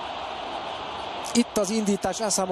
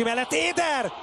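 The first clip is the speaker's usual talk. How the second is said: excited name call; 9 semitones higher than usual. Real, not acted. Chance called live.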